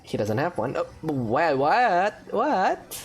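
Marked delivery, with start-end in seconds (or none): none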